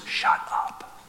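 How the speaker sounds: whispered, hushing shut up; hushed shut up. Pretend. Inner fear whispering to stay silent, whispered. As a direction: hiss it like fear telling you to hush.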